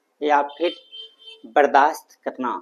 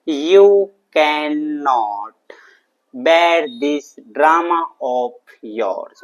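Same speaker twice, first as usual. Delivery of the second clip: very slow, each word stretched out and separated; slow model sentence; much slower than usual. Shown as a demonstration. English teacher giving students the answer, slowly and deliberately drawn out. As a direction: dictate it word by word for learners.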